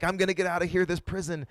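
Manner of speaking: acted hopeful anticipation; hopeful Joseph. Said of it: "Joseph finally is like".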